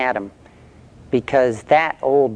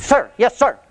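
recruit's reply; 9 semitones higher than usual. Retold or imitated. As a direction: fire it back like a scared recruit. Recruit answers the drill sergeant.

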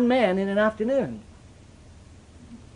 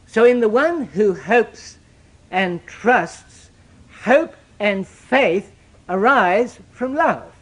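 drawn out, every word weighed; slow it right down to drive it home; deliberate preacher's emphasis; 2 semitones higher, 3 dB louder, much slower than usual. Shown as a demonstration. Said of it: "slow emphatic teaching".

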